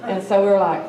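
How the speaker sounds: normal talking voice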